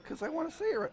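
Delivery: high, earnest mock explanation; retold reply. Announcer explaining to the player why he asks, high mock earnest voice. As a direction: squeak it out like a harmless eager newcomer. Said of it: "I was like".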